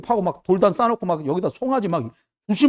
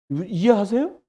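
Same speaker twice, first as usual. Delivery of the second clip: usual speaking voice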